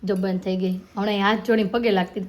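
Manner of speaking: ordinary talk, nothing marked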